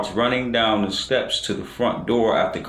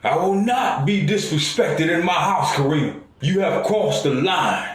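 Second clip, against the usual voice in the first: raised, higher-pitched angry declaration; angry yell; 7 semitones higher than usual. A narrator's reading. Father yells after daughter driving off, angrily. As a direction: lay down the law in a moderate yell. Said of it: "as he yells out loud".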